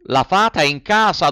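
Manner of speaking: high, eager puppet calling up. A narrator's reading. Pinocchio voiced in story. (burattino ansioso)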